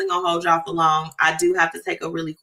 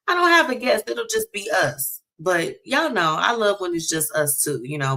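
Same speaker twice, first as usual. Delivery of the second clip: ordinary talk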